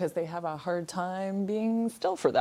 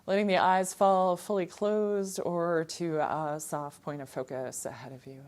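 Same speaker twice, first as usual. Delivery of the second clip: calm, unhurried yoga-teacher voice with long pauses; guided meditation; 3 semitones lower than usual. Real, not acted. Yoga teacher guiding students to settle in and draw awareness inward, calm meditation voice. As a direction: settle them gently inward, phrase by phrase. Leads seated meditation opening.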